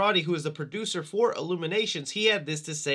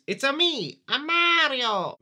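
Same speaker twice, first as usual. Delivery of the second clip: put-on Mario's Italian accent; 8 semitones higher, slower than usual. Vlogger quoting the game's catchphrase, hammed-up Italian accent. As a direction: stretch out "a Mario" in a high cartoon Italian accent. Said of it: "Mario's accent from the original game".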